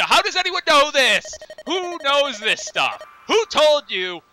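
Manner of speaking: mock outrage. Player mocking all-knowing characters, high incredulous squeal.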